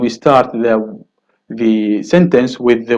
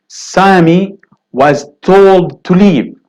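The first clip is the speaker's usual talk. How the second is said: slow and louder, stressing told; slow emphatic example; 5 semitones higher, 5 dB louder, slower than usual. Shown as a demonstration. Teacher stressing told in the example, slow and loud. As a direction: lay out each word slowly, leaning on told. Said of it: "You would say, for example".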